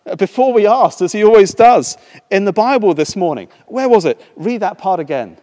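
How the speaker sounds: high, loud eager rush; bursting with excitement; excitedly. Acted. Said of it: "she said excitedly".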